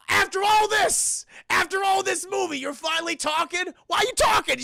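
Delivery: shocked high shout. Retold or imitated.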